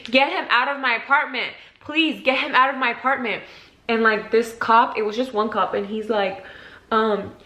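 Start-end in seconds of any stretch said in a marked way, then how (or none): 0.0-3.4 higher-pitched retold desperate plea; tearful pleading. Begging the cop to remove the man, tearful pleading. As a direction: plead urgently as if crying. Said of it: "I start crying and saying".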